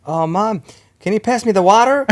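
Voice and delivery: high, loud mock American kid asking politely; imitating the nephew's American accent. Nephew mimicked asking his mom for water in American accent. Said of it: "my nephew's like".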